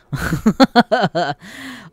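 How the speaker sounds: high loud burst of laughter. Real, not acted. Laughing at his own retort to a commenter. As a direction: cackle at his own comeback.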